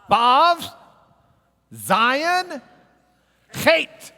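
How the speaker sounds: high, loud proclaimed letter name; loud announced answer. Acted. Teacher revealing each letter to the class, loud and high. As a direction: boom it out like a quiz reveal.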